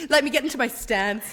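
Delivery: sucking in the belly; holding the stomach in. Real, not acted. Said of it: "careful with that finger".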